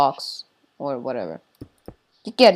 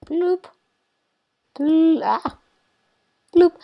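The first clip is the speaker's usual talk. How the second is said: bloop sound; 11 semitones higher, 5 dB louder, slower than usual. Retold or imitated. Imitating water flowing sound.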